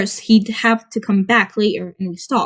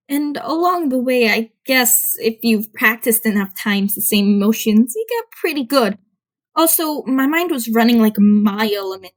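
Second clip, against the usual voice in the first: usual speaking voice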